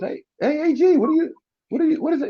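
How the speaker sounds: high-pitched, startled coach calling out; retold high, puzzled call; surprised coach. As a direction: act out a coach caught off guard. Coach spots him, surprised.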